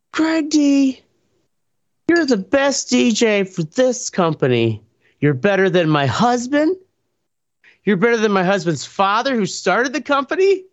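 wife praising the DJ in front of everyone, in a high gushing voice. Retold or imitated. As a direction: mimic the wife raving over the DJ.

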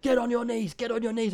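high mock police barking; bossy cop. Mocking the admin cops to his mate, high bossy yell. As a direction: ham up the jobsworth copper. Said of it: "and they were like".

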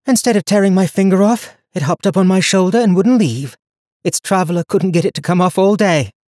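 put-on young woman voice. Donia continues her story.

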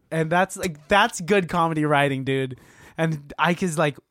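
usual speaking voice

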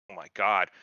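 ordinary talk, nothing marked

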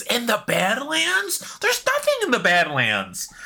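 high disbelieving squeak then scoff; can't believe it's there; incredulous. Real, not acted. Incredulous reaction reading patch notes.

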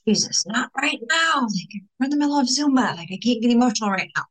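half-laughing protest to hold back tears; mild flustered plea. Retold or imitated. Begging Jesus mid-Zumba not to make her cry, flustered. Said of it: "And I was like".

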